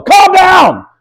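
very high, loud mock-frantic yell; hammed-up, full-voiced shout. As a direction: scream it over someone flipping out. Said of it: "and you yell".

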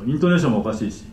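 ordinary delivery

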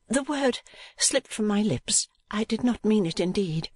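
apologetic niece, pitched high; urging niece. A narrator's reading. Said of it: "urged Kate".

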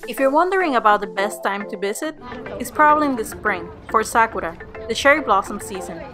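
travel show host. Acted. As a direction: clear tour guide narration. Narrates castle tour voiceover.

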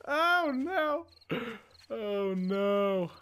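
high, frightened oh no; startled panic with a gasp; panicked. Real, not acted. Zombies closing in, gasp.

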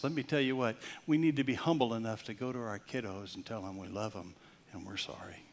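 normal talking voice

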